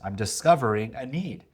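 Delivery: normal talking voice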